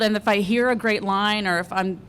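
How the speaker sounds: normal talking voice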